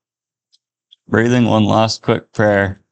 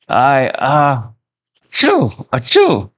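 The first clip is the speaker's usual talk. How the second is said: high, slow stuttering build into a sneeze; hammed-up sneeze; acted sneeze; 4 semitones higher, much slower than usual. A narrator's reading. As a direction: splutter then burst into a sneeze. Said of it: "He sneezed violently".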